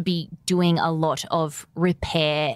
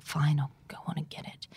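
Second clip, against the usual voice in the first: whisper; 5 semitones lower, 11 dB quieter than usual. Retold or imitated. Grudging reply, whispered.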